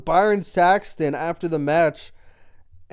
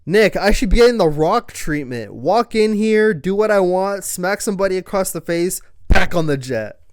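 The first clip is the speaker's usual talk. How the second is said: arrogant demand; 5 semitones higher, 7 dB louder than usual. Retold or imitated. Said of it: "he says".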